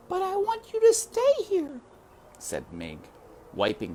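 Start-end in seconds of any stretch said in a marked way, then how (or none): 0.1-1.8 put-on very high, insistent child voice; cling on, begging; high little girl begging her mother to stay; pleading little girl. Child Mig begs.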